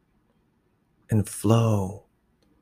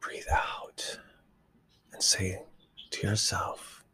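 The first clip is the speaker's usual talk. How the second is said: soft spa whisper; 6 dB quieter than usual. Acted. Exhale instruction in meditation.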